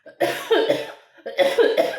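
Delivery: loud, exaggerated snorting choke; mock snotty choking. Storyteller shows how she choked while crying, loud snorty splutter. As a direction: hack and splutter noisily in imitation. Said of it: "Choking on my own snot".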